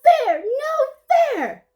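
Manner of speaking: high, slow squeaky wail of protest; whiny bird in a rage. Acted. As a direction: wail it like a sulky little bird. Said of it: "Honey Guide is in a major rage".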